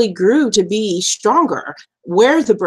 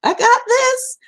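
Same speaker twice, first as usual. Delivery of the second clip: put-on high, far cocky kid voice; mock bravado, pitched way up. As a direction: brag it like a kid who's got it.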